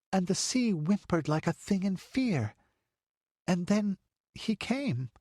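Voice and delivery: put-on a wide-eyed girl recounting the stranger; little girl's tale. A narrator's reading. Child tells what she saw.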